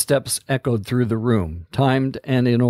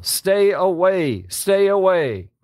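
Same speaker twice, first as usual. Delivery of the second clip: desperate shout; 7 semitones higher, slower than usual. Acted. Ranger waves hatchet, panicking.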